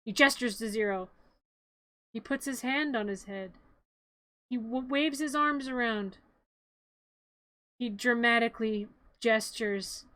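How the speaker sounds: ordinary delivery, nothing marked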